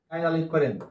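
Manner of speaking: ordinary talk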